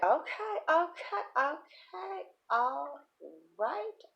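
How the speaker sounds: high, halting, rising okays; strained compliance. Acted. Speaker answering the son's demands, high hesitant compliance.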